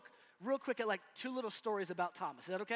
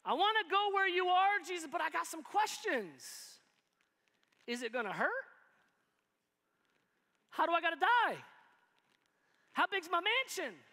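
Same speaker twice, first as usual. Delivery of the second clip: Thomas questions; 6 semitones higher, 3 dB louder than usual. Retold or imitated. Thomas quizzing Jesus.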